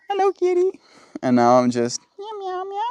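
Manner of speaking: very high cooing pet voice. Real, not acted. Vlogger pausing to coo at the cat, high baby talk. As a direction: squeak it up high for the kitty.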